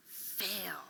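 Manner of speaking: an unvoiced, hushed "Fail."; whispered fail. Real, not acted. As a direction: breathe the word Fail. softly. Whispered echo of teacher's word.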